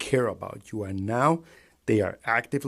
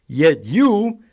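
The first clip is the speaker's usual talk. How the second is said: loud, higher accusing yell; angry shout; 9 semitones higher, 9 dB louder than usual. Real, not acted. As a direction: call them out furiously. Angry rant at Trump voters.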